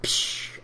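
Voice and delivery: unpitched "psh" rimshot sound; mouthed cymbal crash. Pretend. Co-host punctuates the duck joke reveal, mouthed cymbal crash. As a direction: burst out a cymbal hiss. Imitated cymbal after the announcement.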